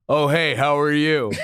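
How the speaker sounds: imitating a teen boy's deep greeting; mock a teenager's deep hello; low teen voice. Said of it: "why do you have that low-ass voice".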